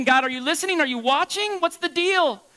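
high, loud complaint to God; acted exasperation, rising and loud; exasperated plea. Acts psalmist complaining to God.

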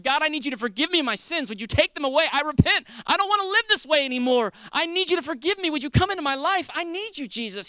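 urgent, high-pitched plea to God; pleading prayer. Acted. Sinner asking Jesus for forgiveness, pleading. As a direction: plead earnestly, voice lifted high.

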